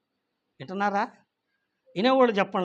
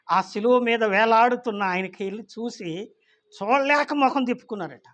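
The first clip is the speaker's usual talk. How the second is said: usual speaking voice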